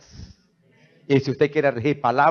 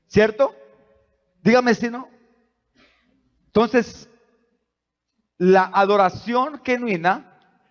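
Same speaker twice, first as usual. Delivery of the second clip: raised, high, urgent sermon voice; preaching at full force; 7 semitones higher, 3 dB louder than usual. Acted. Pastor stirring the church, preaching at full force. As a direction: preach it out to the congregation.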